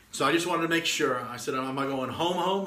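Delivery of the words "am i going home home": "home, home" with the second "home" pitched up; retold double-check, stressing the second "home"; questioning emphasis. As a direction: lift the repeated "home" into a question. Checking which home is meant.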